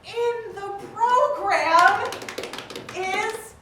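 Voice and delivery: slow, loud hyped intro; building announcer call. Acted. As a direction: draw it out like a ring announcer.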